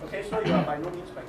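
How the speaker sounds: ordinary talk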